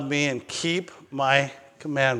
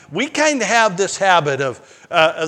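ordinary talk

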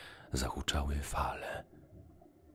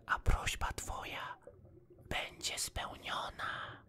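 magical fish; 5 dB quieter than usual. A narrator's reading. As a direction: promise it in an airy whisper. Magic golden fish speaks.

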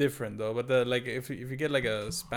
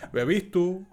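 put-on Spanish-accented German. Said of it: "speaking in a Latin American, like, a Spanish accent".

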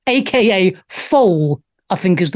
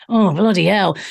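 usual speaking voice

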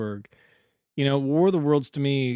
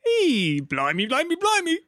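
higher, hammed-up Cockney "blimey"; mock Cockney; 13 semitones higher than usual. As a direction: play a cartoon Londoner. Joining the mock English routine.

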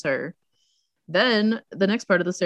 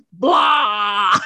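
loud, drawn-out "blah" cry; hammed-up monster yell; mock vampire roar; 2 semitones higher, 6 dB louder than usual. Guy on the stairs during the fight, mock vampire roar. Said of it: "he literally goes like".

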